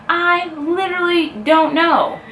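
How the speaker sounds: high, drawn-out mock wail; hammed-up tearful helplessness, slow and high. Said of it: "in tears in the car".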